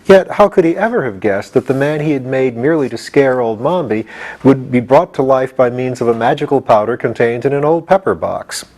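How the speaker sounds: usual speaking voice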